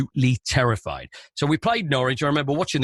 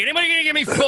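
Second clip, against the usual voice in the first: mock auctioneer; 13 semitones higher, much faster than usual. Co-host taking score predictions, hammed-up higher auctioneer call.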